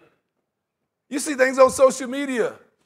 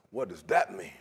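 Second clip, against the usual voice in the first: much lower, softer mock indignation; low suspicious blow-up; 8 dB quieter than usual. Preacher acting someone reading a text, low mock indignation. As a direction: grumble it low, as if offended. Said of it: "you immediately blow up like".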